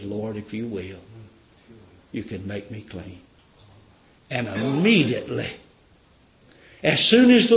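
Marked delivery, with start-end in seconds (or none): 0.0-3.2 much softer, lower, fading plea; weak dying plea. Acted. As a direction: beg with the last of his strength. Dying leper's plea, retold.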